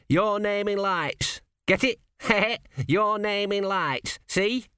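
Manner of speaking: high, chuckling lad milking a pun; slow and gleeful, laughing through it; an apprentice cracking himself up over his own pun; laughing at own joke. A narrator's reading.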